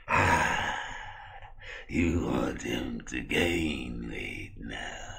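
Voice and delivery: very slow, low, soft creepy voice; sinister drawn-out doctor drone; Victor. Acted. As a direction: stretch every word out eerily. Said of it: "why do you talk like that".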